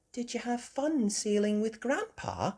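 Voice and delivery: high, gentle mother voice; Mummy Pig. Acted. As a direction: ask high, without raising volume. Mother greets returning children.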